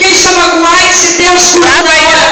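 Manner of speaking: rising, rhythmic proclamation of miracles; full-force preaching. Real, not acted. Preacher declaring healings to the church, full-force preaching. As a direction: drive it home phrase after phrase.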